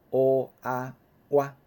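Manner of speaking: 乌啊哇 said slowly, 乌 and 啊 sliding into 哇; teacher drawing out 乌啊哇; slow blend. Shown as a demonstration. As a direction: stretch 乌啊哇 to show how it merges. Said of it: "咱来读作乌啊".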